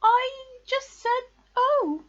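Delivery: high, loud anxious squeak; nervous Piglet. A narrator's reading. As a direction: squeak a flustered explanation. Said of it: "said Piglet nervously".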